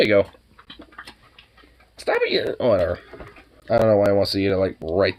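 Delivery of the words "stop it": squeaky, much higher "stop it"; high-pitched scold. Real, not acted. Owner squeakily telling the dog to stop. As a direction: yelp it at him.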